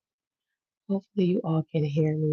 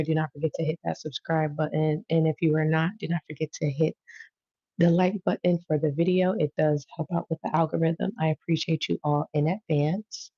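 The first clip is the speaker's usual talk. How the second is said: usual speaking voice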